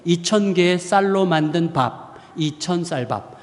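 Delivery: slow, deliberate spelling out; slow child's reading. Shown as a demonstration. Preacher showing how the child read the label, slow. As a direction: read it word by word, slowly. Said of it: "완전히 해석이 되는 거예요".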